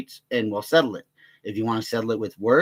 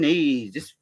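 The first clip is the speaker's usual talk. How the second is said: usual speaking voice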